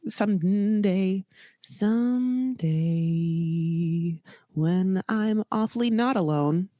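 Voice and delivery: slow, stretched-out musing; drawn out, savouring each word; drawn-out daydream. Acted. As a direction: linger on the words as if dreaming ahead. Wistful aside about a luxury trip.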